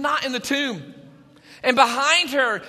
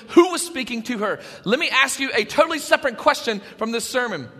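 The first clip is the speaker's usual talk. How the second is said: usual speaking voice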